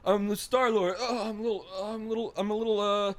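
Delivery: mock Chris Pratt, halting and unsure; Chris Pratt impression. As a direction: stammer like a nervous Star-Lord. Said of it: "I'm Chris Pratt".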